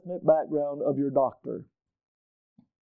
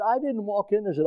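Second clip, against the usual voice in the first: ordinary talk, nothing marked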